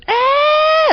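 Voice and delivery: very high-pitched scream, held long; imitated scream. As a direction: imitate a startled neighbor's shriek. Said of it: "all I hear is".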